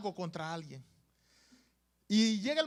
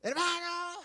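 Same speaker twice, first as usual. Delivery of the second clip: grito agudo y sostenido; grito de demostración; 7 semitones higher than usual. Shown as a demonstration. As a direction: alarga la palabra como quien grita a alguien. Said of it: "ustedes ya saben qué es gritar". (grito fingido para mostrar qué es gritar)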